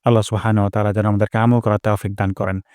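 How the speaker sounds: ordinary talk, nothing marked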